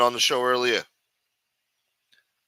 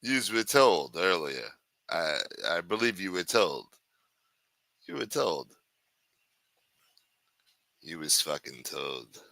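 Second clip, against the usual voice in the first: quiet, lower scolding grumble; talk like this; 5 semitones lower, 6 dB quieter than usual. Acted. Streamer scolding absent viewers, quiet low grumble. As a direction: mutter the scolding under your breath. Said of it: "I'll just talk like this all night".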